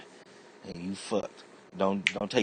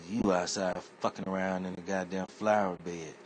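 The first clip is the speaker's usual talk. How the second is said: ordinary talk, nothing marked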